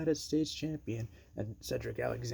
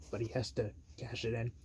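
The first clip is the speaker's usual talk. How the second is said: ordinary talk, nothing marked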